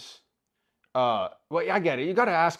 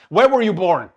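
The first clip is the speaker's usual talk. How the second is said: loud exasperated demand; 4 semitones higher, 9 dB louder than usual. Retold or imitated. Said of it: "I'm like".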